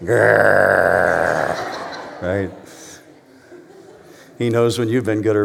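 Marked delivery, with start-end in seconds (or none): 0.0-2.2 dog growl. Pretend. Mimics pit bull after joke.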